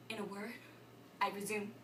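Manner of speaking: collect yourself quietly after an outburst; hushed, low resumption; subdued restart. Acted. Acted rant collapses, restarts.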